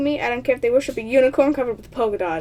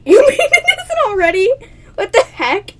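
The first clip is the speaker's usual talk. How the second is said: laughing disbelief; 9 semitones higher, 8 dB louder than usual. Real, not acted. Laughing disbelief at own line.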